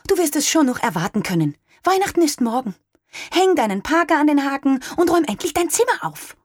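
high, quick, irritated mother; mother mildly snapping at her son; a bit irritated. A narrator's reading. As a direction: brush the boy off, a little annoyed. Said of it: "Stimme klang ein wenig gereizt".

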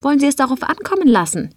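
high and fast, daring him; heated challenge. Acted. Joe challenges the chef.

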